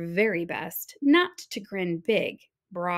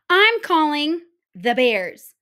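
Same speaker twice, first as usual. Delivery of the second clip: determined bunny; 5 semitones higher, 8 dB louder than usual. Acted. Bunny on phone.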